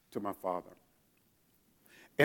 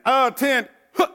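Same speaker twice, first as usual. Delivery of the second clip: high, loud drawn-out command shout; parade ground command; 11 semitones higher, 8 dB louder, slower than usual. Retold or imitated. Private commanding seventy-seven Marines, loud command call. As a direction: bellow it across the parade ground. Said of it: "I gave the command".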